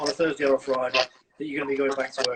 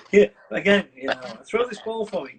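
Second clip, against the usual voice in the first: pestering dog; 5 semitones higher, 4 dB louder than usual. Retold or imitated. Dog's demands voiced by owner.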